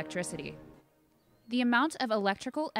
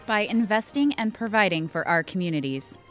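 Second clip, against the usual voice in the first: loud, punchy ad climax; hard sell ad; 8 dB louder than usual. Acted. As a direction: sell it big and bold.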